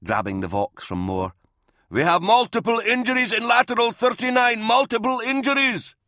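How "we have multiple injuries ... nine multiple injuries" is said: urgent shout. A narrator's reading. Captain shouting over the vox.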